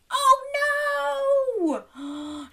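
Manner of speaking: high wail, falling away; mock horror. Storyteller watching wolf pounce on Little Red, high mock horror. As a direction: wail it in pretend alarm.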